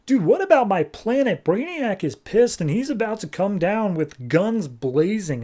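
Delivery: high, whiny panicked protest; hammed-up frantic objection; mock alarm. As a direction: plead in exaggerated alarm. Acted protest about planet under threat.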